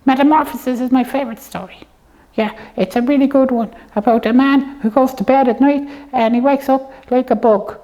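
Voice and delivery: usual speaking voice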